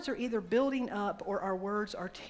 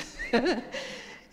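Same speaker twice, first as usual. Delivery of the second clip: short unvoiced chuckle; breathy laugh; 5 semitones higher, 5 dB louder than usual. Real, not acted. Preacher chuckling at the cheeky boy, breathy laugh.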